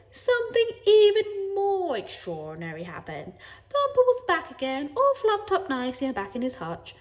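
relate the pet's death with great drama; storytelling neighbour. A narrator's reading.

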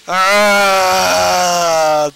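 sudden loud scream; startled yell. Real, not acted. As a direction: yelp in fright. Startled by monster in game.

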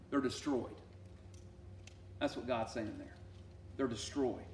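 normal talking voice

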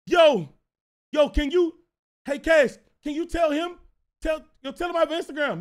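put-on high, loud kid hailing someone; kid calling out. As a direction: call over urgently for a favor. Acting kid calling out to Cash.

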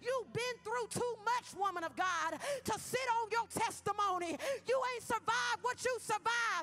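loud, high, fiery preaching over music; full-force preaching. Acted. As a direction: call the people of God out of hiding. Opening exhortation at full force.

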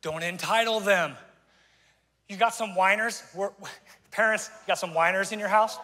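ordinary delivery

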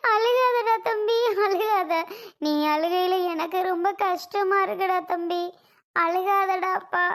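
sob, then beg him through tears; tearful pleading. Acted. Woman begging her younger brother not to cry, tearful.

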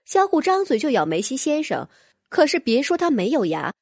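ordinary delivery, nothing marked

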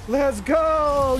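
loud, high cheer; victory shout. Real, not acted. Cheering the squad's win at the end of the match. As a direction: yell it out after the win.

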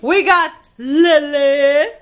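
loud, high, slow reveal; excited announcement. Real, not acted. Announcing her little sister to viewers, loud and excited. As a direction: present her like a prize guest.

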